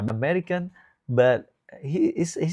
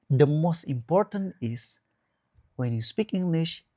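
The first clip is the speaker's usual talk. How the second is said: normal talking voice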